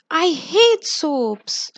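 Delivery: understated storybook scream, drawn out; mild narrated scream. A narrator's reading. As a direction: cry it out slowly, storybook-style. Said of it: "she screams".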